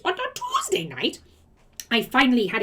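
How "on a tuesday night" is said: much higher "On a Tuesday night?" in disbelief; incredulous echo. Real, not acted. Scoffing at the upline's demand, high incredulous echo. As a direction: repeat it like it's absurd.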